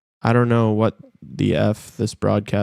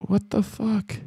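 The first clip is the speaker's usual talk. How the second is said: disbelief; 10 semitones higher than usual. Real, not acted. Disbelief at Lakers loss.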